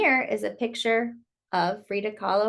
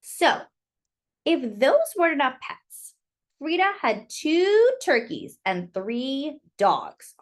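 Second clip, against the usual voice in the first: put-on slow, lilting read-aloud for kids; story narration; 4 dB louder, slower than usual. Reading Frida's story to children, lilting storyteller voice. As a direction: tell it like a bedtime picture book. Reads picture book aloud.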